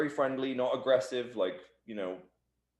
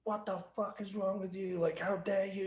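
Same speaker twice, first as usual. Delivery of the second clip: retold yelling; 9 semitones higher, 6 dB quieter than usual. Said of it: "just yelling at this guy".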